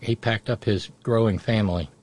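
usual speaking voice

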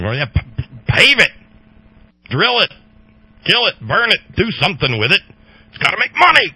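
ranting caricature of profiteers; mocking greedy voice. Mocking greedy free-market freaks.

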